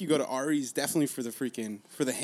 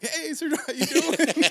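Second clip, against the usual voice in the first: cheerful greeting; 13 semitones higher, 6 dB louder than usual. Retold or imitated. Staff greeting him cheerfully.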